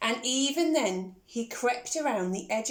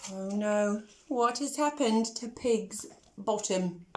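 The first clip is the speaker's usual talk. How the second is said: plain reading voice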